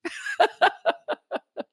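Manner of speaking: real laugh breaking out; high burst of laughter. Real, not acted. Telling the story, he bursts out laughing. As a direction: laugh at the memory. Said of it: "I'm laughing now".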